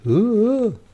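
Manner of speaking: high ghostly ooh; spooky ooh. A narrator's reading. After the scary turn, a high spooky ooh. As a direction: moan it like a ghost.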